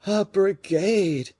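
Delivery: slow, high, alarmed repeat; startled exclamation. A narrator's reading. Fouquet startled at the musketeer's news, high and slow. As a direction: catch the word in alarm. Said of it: "raising himself upon his elbow".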